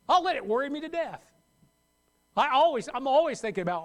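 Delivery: usual speaking voice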